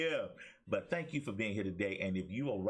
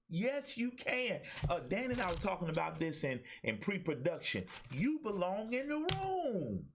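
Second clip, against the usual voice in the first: rising, fervent preacher's cadence, stretched slow and much higher; preaching; 6 semitones higher than usual. Acted. Pastor telling his online viewers they are valuable, fervent preaching. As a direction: preach it home, climbing higher with every line.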